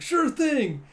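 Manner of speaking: put-on high, loud little-kid reply. Boy answers the captain, high eager kid voice.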